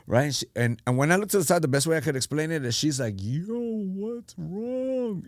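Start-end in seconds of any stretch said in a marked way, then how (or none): 3.2-5.2 very slow, stretched high voice; slow-motion impression of her asking; imitated distorted voice. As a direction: draw every word way out, pitched high. Best explanation of how she sounded.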